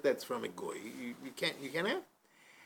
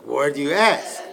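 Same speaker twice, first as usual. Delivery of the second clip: laughing question; 13 dB louder than usual. Acted. Vendors laughing, asking the waiter.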